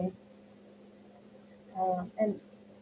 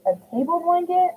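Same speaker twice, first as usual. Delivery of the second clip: surprised question; 8 semitones higher than usual. Real, not acted. Reading a surprising comment.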